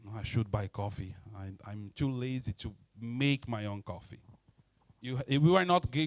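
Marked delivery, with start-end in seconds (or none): 0.0-4.2 low mumbled lazy grumble; grumbling to himself. Retold or imitated. The worker grumbles about making coffee, low mutter. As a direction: grumble low and lazily to yourself. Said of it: "complaining worker inner voice".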